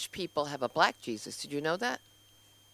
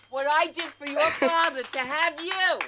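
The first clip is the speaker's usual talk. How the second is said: high, slow guilt-trip mom; 10 semitones higher, 4 dB louder than usual. Acted. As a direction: drag out the guilt. S1 imitates the guilt-tripping mother.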